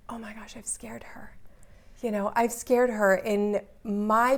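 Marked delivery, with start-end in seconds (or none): 0.1-1.4 stunned whisper, retelling a dawning realization; hushed horror. Retold or imitated. Mother realizing she has scared her daughter, horrified whisper. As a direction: whisper it as a horrified inner thought.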